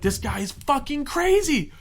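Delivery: high, loud, drawn-out alarm; freaking out. Retold or imitated. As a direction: blurt it in retold panic, slowly stretched. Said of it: "like, freaking out".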